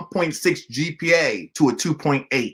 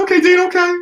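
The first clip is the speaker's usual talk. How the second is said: high, laughing whine; student caught out by the dean; laughing nervously; 13 semitones higher, 5 dB louder, faster than usual. Retold or imitated. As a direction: laugh it off with a pitched-up okay.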